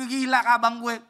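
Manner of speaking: loud high burst of laughter; mocking laughter. Younger siblings laughing at brother's calling, loud mocking laugh.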